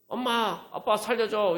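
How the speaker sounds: put-on high, frightened child's cry for mom and dad; child crying for help. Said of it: "울면서 막 달려갈". (겁에 질린 아이처럼 높은 목소리로 부르짖기)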